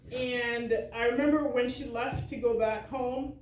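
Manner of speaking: usual speaking voice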